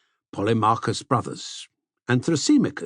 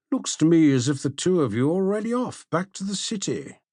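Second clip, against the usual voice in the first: fast, higher, lightly voiced young man; teasing Polemarchus; 6 semitones higher than usual. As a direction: tease him about slipping away.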